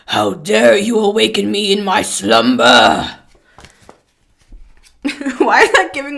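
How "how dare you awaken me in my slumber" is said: grand wizardly dragon. Pretend. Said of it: "Gandalf or something".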